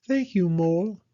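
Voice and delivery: high little creature voice, grateful; sighing Hedgehog. Acted. Hedgehog thanking Mole for listening, high sighing character voice. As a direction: thank them with a sigh in a small animal voice. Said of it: "Hedgehog sighed".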